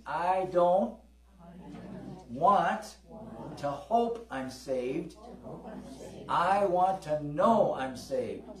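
slow, emphatic words for the congregation to repeat; spoken slowly so the crowd can echo it; slow call-and-response. Shown as a demonstration. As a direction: lead the church line by line, leaning on each word. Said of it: "Say this with me".

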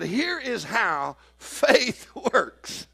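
very slow, laughing explanation; laughing quote. Acted. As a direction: explain it slowly while cracking up. Jesus quote broken by laughter.

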